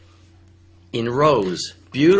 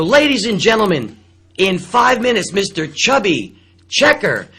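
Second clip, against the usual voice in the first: loud, higher showman's call to the room; emcee announcement; 6 semitones higher, 5 dB louder than usual. Retold or imitated. Onstage to the crowd, a loud showman announcement.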